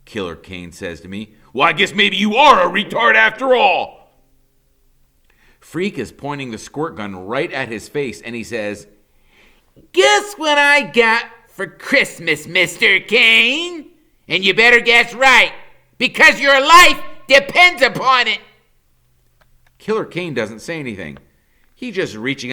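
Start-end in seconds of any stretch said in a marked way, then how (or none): 1.5-4.1 loud, mocking villain voice; sneering villain. A narrator's reading. Killer Kane mocking Freak, loud and sneering. 9.9-18.4 put-on hammy kid hero threat. A narrator's reading. Freak threatening Killer Kane with his squirt gun.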